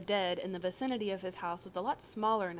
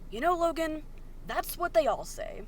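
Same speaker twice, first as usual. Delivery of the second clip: higher, louder sarcastic accusation; biting sarcasm, pitched up and loud; sarcastic jab; 8 semitones higher, 5 dB louder than usual. Acted. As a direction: throw his excuse back at him mockingly. Virgil confronts Logan sarcastically.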